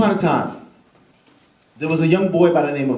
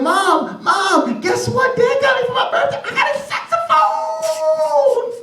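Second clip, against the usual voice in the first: put-on very high, thrilled kid calling; high shouting boy; excited child shout; slower than usual. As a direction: shout the birthday news upstairs.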